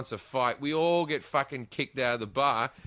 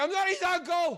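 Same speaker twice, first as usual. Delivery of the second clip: high, laughing protest; laughing as he denies being the uncle; laughing denial; 13 semitones higher than usual. Retold or imitated. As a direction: push the words out through laughter. Baffled denial of fake nephew.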